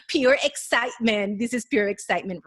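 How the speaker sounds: excited. Real, not acted. Excited, loud.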